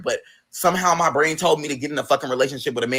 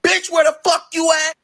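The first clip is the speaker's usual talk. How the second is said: high shrill-free shout of an angry friend; friend yelling; 3 dB louder than usual. Retold or imitated. Friend yelling down the phone at her, furious shout. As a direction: yell it like she's furious you vanished.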